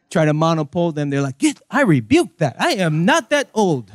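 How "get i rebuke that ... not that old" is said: higher, swooping indignant refusal; hammed-up affronted elder; mock offence. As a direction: rebuke it with theatrical indignation. Acted offended elder refusing mano po.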